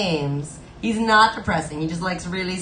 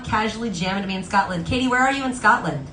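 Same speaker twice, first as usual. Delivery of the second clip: ordinary talk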